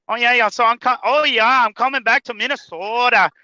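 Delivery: high, hammed-up Minnesotan accent; Minnesota accent. Making fun of the dispatcher, mock Minnesota accent. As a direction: tease with an exaggerated Minnesota accent. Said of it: "make fun of my ... dispatcher 'cause he has the accent".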